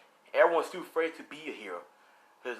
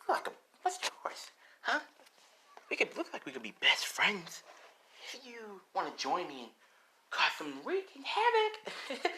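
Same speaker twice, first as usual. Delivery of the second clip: softer, teasing villain with squeaky jumps; playfully crazed Gremlin; 3 semitones higher, 6 dB quieter than usual. Acted. Gremlin inviting Spider-Man to wreak havoc, playfully crazed.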